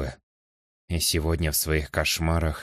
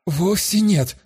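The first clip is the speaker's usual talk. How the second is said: high, loud protest; 12 semitones higher, 5 dB louder, much slower than usual. Acted. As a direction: object sharply, higher and louder. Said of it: "попытался возразить гость".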